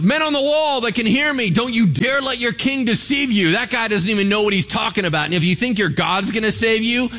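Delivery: high, loud, relentless shout; rally the wall against their king, full volume; a commander shouting threats at the soldiers on the wall. Retold or imitated. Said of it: "And then he goes".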